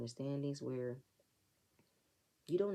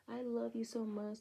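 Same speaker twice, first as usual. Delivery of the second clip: imitating a tipsy friend, pitched up; mock drunk gush; 7 semitones higher than usual. Imitating Robin and Wendy's drunk moment, pitched up and gushing. Drunk women hugging at club.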